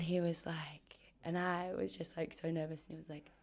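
hushed storytelling. Real, not acted. Tense moment before the news.

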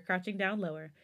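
ordinary delivery, nothing marked

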